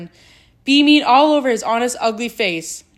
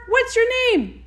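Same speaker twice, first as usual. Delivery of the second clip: roaring call; 12 semitones higher than usual. A narrator's reading. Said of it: "he roared".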